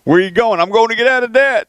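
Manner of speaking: high, playful put-on voice for the Windstar crowd; mock gambler exchange. Radio host joking about debtors heading to the casino, high mock voice. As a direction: toss off a silly imagined gambler's reply.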